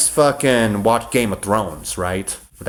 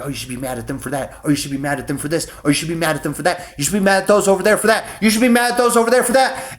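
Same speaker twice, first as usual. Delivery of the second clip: nagging crowd voices; 5 semitones higher, much faster than usual. Retold or imitated. Mocking the people who tell him to be mad at everyone, building fast and high. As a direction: rattle it off faster and faster, voice rising into a shrill taunt. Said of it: "everybody's like".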